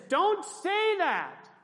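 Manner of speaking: high, drawn-out emphatic command; God's rebuke. Acted. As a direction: cry it out slowly as a sharp correction. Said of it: "And God says this".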